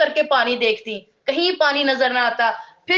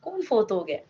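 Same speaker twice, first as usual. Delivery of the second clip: worried child, retold; 6 dB quieter than usual. Girl asking her mother who has died, hushed worry. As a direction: imitate a puzzled pupil quietly asking her mother. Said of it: "وہ بچی اتنی پریشان ہوئی، چپ ہو گئی".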